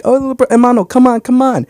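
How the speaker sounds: put-on coaxing a toddler to walk; coaxing baby. Coaxing his baby brother to walk, mild coo. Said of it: "I'm like".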